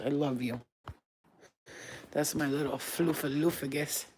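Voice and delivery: low, soft doting murmur; softly adore a little pet; tender cooing. Real, not acted. Owner to fluffy little dog, tender cooing.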